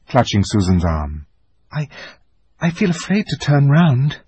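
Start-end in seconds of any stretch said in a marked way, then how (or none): 1.7-4.2 put-on higher, hesitant frightened girl; afraid. A narrator's reading. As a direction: stammer out the fear. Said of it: "said Susan".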